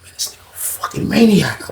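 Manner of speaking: hushed, airy disbelief; whispered disbelief. Retold or imitated. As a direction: alone in the cell, whispering about the maniac.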